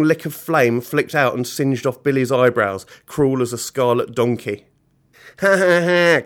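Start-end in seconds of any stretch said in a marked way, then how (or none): 5.4-6.2 loud, higher-pitched villain cackle; mock cackle. Billy enjoying someone getting hurt, cackling. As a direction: cackle in deliberate bursts. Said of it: "cackled Billy".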